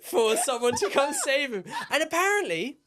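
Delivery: can barely get it out for laughing; giggling while picturing him waiting; laughing through it. Real, not acted. Laughing while telling survival story.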